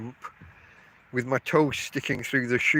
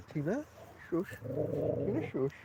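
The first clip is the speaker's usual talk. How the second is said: soft hushing; 3 semitones higher, 12 dB quieter than usual. Real, not acted. Hushing the dog Tina.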